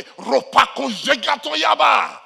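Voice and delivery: loud, high, drawn-out tongues; mock praying in tongues. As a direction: belt out showy tongues into the microphone. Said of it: "They handle the microphone and pray".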